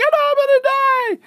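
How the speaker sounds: very high, loud mock terror; panicked shriek. Izzy crashing through the trees, shrieking high. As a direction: squeal as the ground rushes up. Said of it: "Izzy was like".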